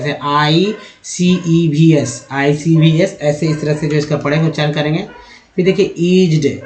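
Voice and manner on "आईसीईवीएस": आईसीईवीएस drawn out slowly, each letter named; spelled letter by letter. Shown as a demonstration. Teacher showing how short forms are read, आईसीईवीएस letter by letter. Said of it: "उसको ऐसे पढ़ते हैं".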